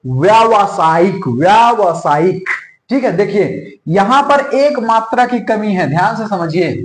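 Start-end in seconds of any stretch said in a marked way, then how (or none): 0.1-2.5 व्यावसायिक spelled out slowly with long आ; correct pronunciation shown. Shown as a demonstration. Teacher repeats व्यावसायिक slowly for the class. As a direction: draw out व्यावसायिक syllable by syllable. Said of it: "इसका सही वर्तनी क्या है".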